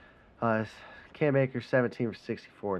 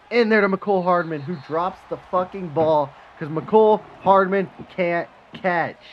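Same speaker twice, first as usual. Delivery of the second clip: annoyed outburst; 7 semitones higher, 9 dB louder than usual. Real, not acted. Receiver drops the ball.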